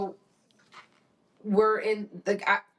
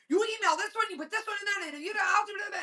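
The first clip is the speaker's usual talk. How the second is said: high-pitched insistent instructions; bossy mom; 13 semitones higher, faster than usual. Retold or imitated. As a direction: imitate a mom rattling off orders to a son. Bossing son, acted by mother.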